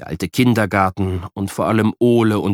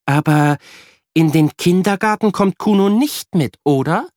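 higher, a hint of a doubtful mum; questioning mother; 9 semitones higher, 4 dB louder than usual. A narrator's reading. Mother asks whether the invisible friend comes along, mild worry. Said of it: "fragt Mama".

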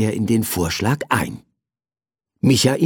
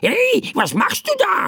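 very high squawky bird voice; bird crying out. Acted. Bird doused with flour by Michael, squawking. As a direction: squawk in indignant surprise. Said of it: "rief der Vogel".

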